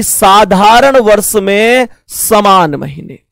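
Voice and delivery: slow, word by word, as if writing on the board; drawn-out classroom dictation. Shown as a demonstration. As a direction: dictate the heading slowly. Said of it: "मैं heading लिखता हूं".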